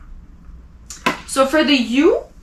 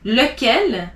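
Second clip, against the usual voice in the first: ordinary delivery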